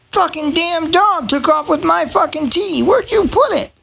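annoyed hunt for the lost tea; exasperated. Real, not acted. Frustrated searching for tea.

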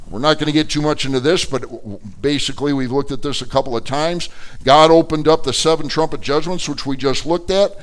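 normal talking voice

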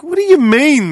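high-pitched disbelieving question; incredulous. Retold or imitated. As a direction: push back in disbelief. Retold protest at the counter.

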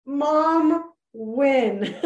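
high, loud delighted cheer; mock triumphant cheer. Mom cheers her daughter's compliment, loud and high. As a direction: cheer it like a proud mom who just won. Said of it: "I was like".

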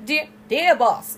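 mock British letter-writer, dropping the R; put-on British accent. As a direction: draw it out in a hammed-up British accent. Letter's opening re-voiced, put-on accent.

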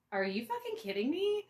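can't believe what you're hearing; incredulous. Retold or imitated. Retold disbelief.

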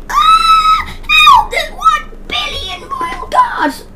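high, frantic shrieking for help; panicked yelling. Pretend. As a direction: shriek like you're overrun. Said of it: "That's basically me when I try and play it".

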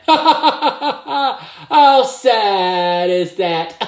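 slow, high words dissolving into laughter; gleeful chuckle at a rival's bad luck; laughing. Real, not acted. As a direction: laugh through mock pity. Mock sympathy for injured rival.

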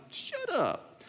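high, soft incredulous shut up; mock disbelief. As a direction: squeal it in disbelief. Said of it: "I'm like".

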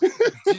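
very high, loud burst of laughter; delighted laugh. Real, not acted. Delighted by Jaws music idea.

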